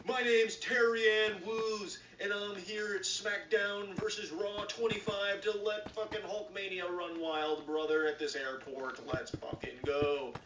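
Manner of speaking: slow booming wrestler entrance; wrestling announcer. Retold or imitated. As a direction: hype it like a WWE promo. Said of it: "announces herself like it's a W W E entrance".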